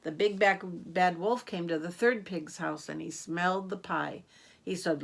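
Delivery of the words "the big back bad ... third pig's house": ordinary delivery, nothing marked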